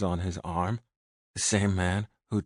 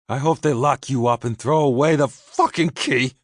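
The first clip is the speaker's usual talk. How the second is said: high, loud, hateful yell; furious shout; 7 semitones higher, 10 dB louder than usual. A narrator's reading. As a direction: scream it with hatred. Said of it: "I was still shouting".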